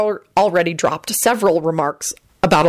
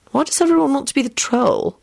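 high, curious question; curious newcomer, pitched up; interested; 8 semitones higher than usual. A narrator's reading. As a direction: ask with lively interest. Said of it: "Elizabeth asked interestedly".

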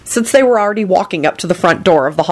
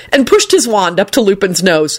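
ordinary delivery, nothing marked

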